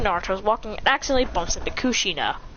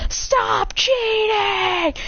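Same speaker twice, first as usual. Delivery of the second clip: long, high wailing yell; drawn-out, squealed complaint; screaming gamer; 12 semitones higher, much slower than usual. Acted. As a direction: stretch it out like a tantrum. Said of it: "screaming from the house".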